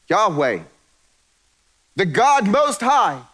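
Most High rising high and loud, slowed; grand proclamation. Shown as a demonstration. God announcing himself grandly to Pharaoh, high and slow. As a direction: proclaim it like a mighty title. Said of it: "He could have said".

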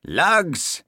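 high, delighted-dog-owner call; crying out. A narrator's reading. Owner greeting his galloping dog, crying out. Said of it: "cried Hamish".

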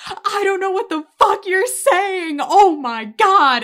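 high, loud mock outburst, dragging out OH MY GOD; exasperated yell. Retelling Mickey yelled at in Russian, exasperated yell. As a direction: shout in baffled frustration. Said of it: "starts yelling at him in Russian, and he just goes".

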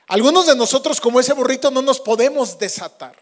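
high, loud preaching; predicación enérgica. Acted. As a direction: lift it up and press on. Predica con fuerza.

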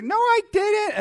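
put-on high-pitched kid's denial; protesting child. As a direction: squeal it like a child defending herself. Child denies hitting sibling.

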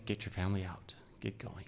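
hushed, urgent warning to get out; urgent whisper. Retold or imitated. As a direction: whisper it like a secret order to flee. Generals urging family to flee.

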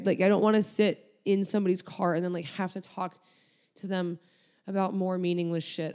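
ordinary talk

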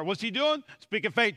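usual speaking voice

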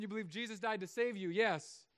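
normal talking voice

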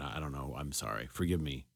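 quietly taking it back, a bit sheepish; sheepish apology. Real, not acted. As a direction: drop way down and apologize. Embarrassed apology after mocking quote.

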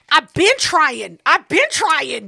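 slow, loud, stretched-out protest; exasperated protest. Retold or imitated. Girlfriend defends herself to boyfriend, slow loud exasperation. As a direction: drag out each word in acted exasperation.

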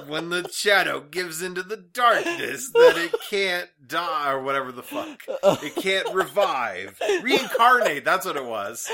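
winded from laughter, catching breath; after laughing hard. Real, not acted. After laughing hard, quoting trailer.

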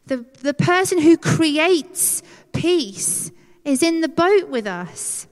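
ordinary talk, nothing marked

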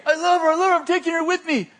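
retold yelling. Pursuer yells at fleeing girl as he chases her, high urgent yelling. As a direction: call it out high and forceful. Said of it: "he's yelling something".

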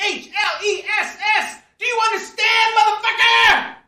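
high furious shout; screaming rage. Acted. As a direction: scream it down the phone. Screaming at phone.

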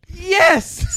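high delighted yes; excited shout. Real, not acted. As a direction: burst out thrilled. Gasp, old Vine picture found.

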